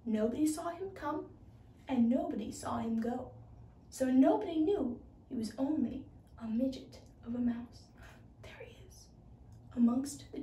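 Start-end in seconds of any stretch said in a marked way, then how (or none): none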